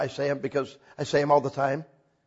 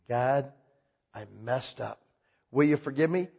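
low, soft little confession; a hushed, low prayer of regret; humble confession; 5 semitones lower, 6 dB quieter than usual. Acted. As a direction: say it softly like a broken admission to God.

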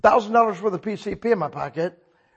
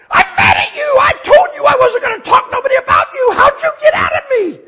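much higher, loud yell at God; mock angry yelling; 10 dB louder than usual. Trucker yelling at God in his truck. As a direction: shout at God in fond fury. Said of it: "I slam the door and I said".

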